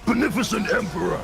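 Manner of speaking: high, loud fanatic zealot cry; zealot voice line. Retold or imitated. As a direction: proclaim it like a zealot preacher.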